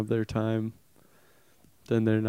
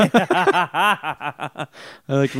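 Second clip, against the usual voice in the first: laughing; 5 semitones higher, much slower than usual. Real, not acted. Laughs while starting the line.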